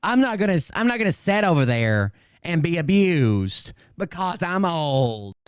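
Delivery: high, put-on old lady grumbling; mock granny complaining; cranky granny voice. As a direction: play an offended old woman. Mocking a cranky granny viewer.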